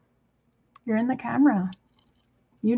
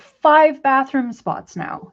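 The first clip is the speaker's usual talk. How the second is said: slow, each word weighted; exasperated slow emphasis; slow emphatic count; 3 semitones higher, 3 dB louder, much slower than usual. Real, not acted. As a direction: drive home how many spots he has. Listing bird's toilet spots.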